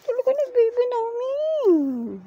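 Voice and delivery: put-on high, sing-song praise for a toddler; mother cooing praise at her baby; baby talk.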